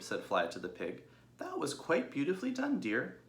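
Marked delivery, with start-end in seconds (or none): 1.4-3.2 much higher, fond motherly dog; Fly praising Babe, fond and proud; praising sheepdog. Acted. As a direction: praise her foster son. Fly's voice.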